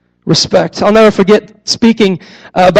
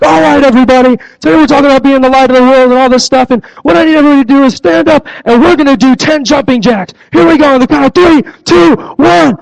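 put-on rally the kids like an eager drill sergeant; 4 semitones higher than usual. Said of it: "come out as the commander of light".

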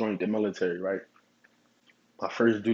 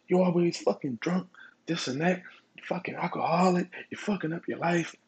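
high, pitched-up mock yell at a drunk; retold yelling; 8 semitones higher than usual. Sergeant yelling at drunk soldier outside the barracks, high mock yell. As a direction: imitate the sergeant chewing him out, voice way up. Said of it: "the one guy's yelling at the other guy".

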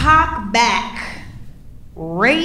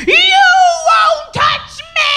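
shrill drawn-out screaming; slower than usual. Retold or imitated. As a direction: scream him off you, slowly. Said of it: "I was like".

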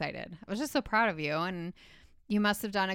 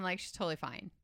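usual speaking voice

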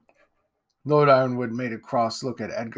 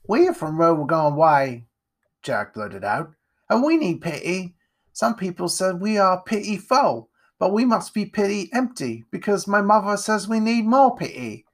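put-on higher, cheeky child voice; little Jack; 8 semitones higher, 4 dB louder than usual. Jack introducing himself to the lord, childlike. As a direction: play an eager little urchin.

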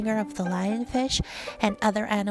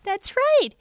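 plain reading voice

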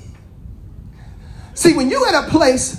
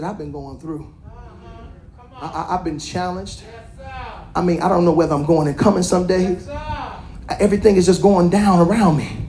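weary confession; 7 semitones lower, 3 dB quieter than usual. Acted. Hannah voiced low and quiet.

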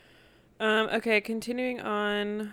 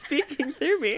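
laughing; 7 semitones higher, slower than usual. Real, not acted. Still laughing at the slip.

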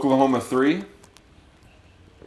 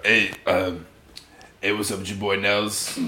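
lower, put-on host voice; Nels; 5 semitones lower, 5 dB quieter than usual. Host does his intro for new listeners, low put-on voice. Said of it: "I gotta do the voice".

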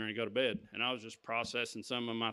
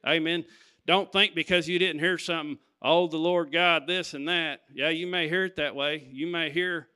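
louder, rising preacher's call to the church; preaching; 3 semitones higher, 6 dB louder than usual. Acted. Preacher exhorting his congregation, loud and rising. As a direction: drive it home to the congregation.

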